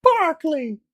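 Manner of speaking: high, loud shrill nasal Barkley. Acted. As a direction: squeal it through the nose. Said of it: "in a shrill, nasally".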